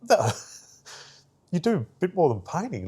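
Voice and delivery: high, laughing disbelief; amused boss. Retold or imitated. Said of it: "she goes".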